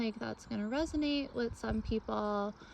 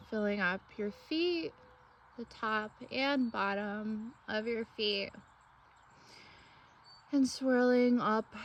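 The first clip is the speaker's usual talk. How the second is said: guided meditation. Acted. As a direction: lead them gently through breath and imagery, phrase by phrase.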